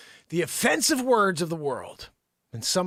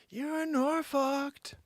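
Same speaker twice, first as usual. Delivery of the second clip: very slow, softer, pitched up; drawn-out verdict; 4 semitones higher, 6 dB quieter, much slower than usual. Acted. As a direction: stretch it out softly. Drawn-out punchline.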